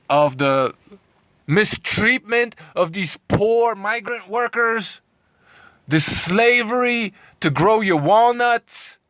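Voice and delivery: real indignant outburst at the vegans; heated rant. Real, not acted. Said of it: "I'm a little bit heated".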